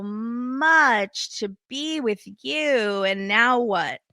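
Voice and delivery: very slow, drawn-out mocking singsong; passive-aggressive. Woman laying a guilt trip on her boyfriend, passive-aggressive. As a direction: imitate her guilt trip, stretching out the stressed words. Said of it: "starts in with this passive aggressive".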